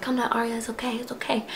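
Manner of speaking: hushed, soft reassurance; whispered calming. Retold or imitated. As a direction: whisper to settle her down. Girls calming her, whispered.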